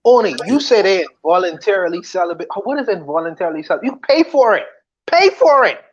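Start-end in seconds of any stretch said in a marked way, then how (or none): 3.8-5.8 high, loud, emphatic pay for it; loud insistence. Real, not acted. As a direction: push the point hard, almost shouting. Insisting loudly on paying.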